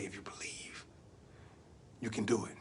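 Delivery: low, very soft near-whisper; hushed in the ear. Acted. Uncle leans to nephew's ear, hushed encouragement. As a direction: murmur it close into his ear. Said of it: "Leaned over to my ear".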